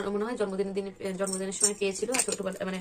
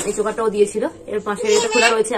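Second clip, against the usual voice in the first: ordinary talk, nothing marked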